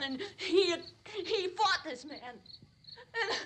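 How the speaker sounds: ordinary talk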